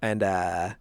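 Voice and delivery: ordinary talk, nothing marked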